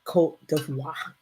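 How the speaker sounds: Côte d'Ivoire said in French, koht-deev-WAH; Côte d'Ivoire with French sounds; Côte d'Ivoire, French style; French pronunciation. Shown as a demonstration. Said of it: "be referred to as Côte d'Ivoire".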